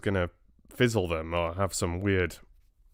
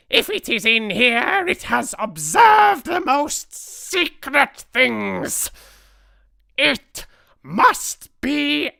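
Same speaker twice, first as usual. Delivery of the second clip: very high, slow, loud screech; screeching Grand High Witch; 11 dB louder, much slower than usual. A narrator's reading. Grand High Witch orders extermination of the spy, drawn-out screech. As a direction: screech it, hugely stretching the key word. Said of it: "screeched the Grand High Witch".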